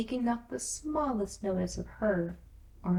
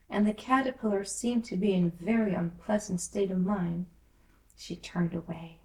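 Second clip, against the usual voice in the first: usual speaking voice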